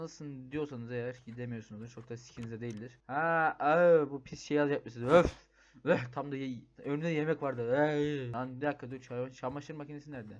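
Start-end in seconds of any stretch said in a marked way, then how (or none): 5.1-5.5 loud, sharp "ugh" of disgust; gagging yuck; disgusted groan. Real, not acted. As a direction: recoil at the gross thing. Disgusted by something gross.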